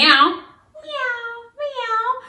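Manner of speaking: high mock meowing; cat meow. Shown as a demonstration. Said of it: "cats like to meow".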